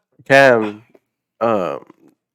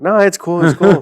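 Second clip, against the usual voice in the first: laughing reassurance; 8 semitones higher, 9 dB louder than usual. Retold or imitated. Retold reply, laughing.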